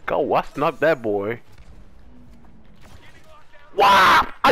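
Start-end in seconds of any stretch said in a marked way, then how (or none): 3.8-4.5 shout. Real, not acted. Sudden hit in game.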